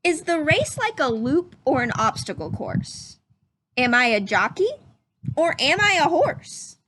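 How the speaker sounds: lively, curious rising questions; questioning read-aloud voice. Acted. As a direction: read each question like a wondering child. Reads story questions aloud.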